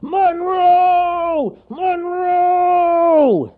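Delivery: repeated long booming hollers; high-strained foghorn calls; bear shouting. A narrator's reading. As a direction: bellow the name far and wide. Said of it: "all the while shouting".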